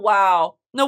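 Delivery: ordinary talk, nothing marked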